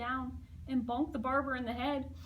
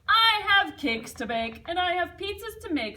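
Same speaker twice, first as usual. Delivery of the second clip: cheerful; 5 semitones higher, 11 dB louder than usual. Acted. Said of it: "said the pizza man".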